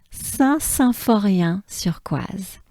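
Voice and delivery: slow French "Saint-Symphorien-sur-Coise"; French pronunciation demo. Shown as a demonstration. Teacher naming the departure town, slow French pronunciation. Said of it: "Let's break these down".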